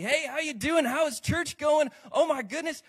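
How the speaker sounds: high, loud chirpy greeting; fake church cheer. Preacher mocking churchgoers' fake cheerful greeting. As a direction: put on a beaming church-lobby hello.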